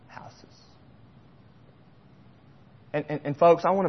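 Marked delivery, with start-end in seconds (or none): 0.1-0.6 whispered softly. Acted. Preacher reading scripture to church, whispered. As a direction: let the word fall to a whisper.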